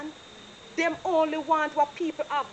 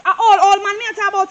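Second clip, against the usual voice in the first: retold outburst; 5 semitones higher, 11 dB louder than usual. Worker retells her own outburst.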